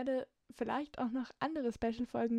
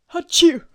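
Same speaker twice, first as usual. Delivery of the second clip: loud sudden sneeze; big explosive sneeze; sneezing; 5 semitones higher, 20 dB louder than usual. Real, not acted. Outtakes full of sneezes.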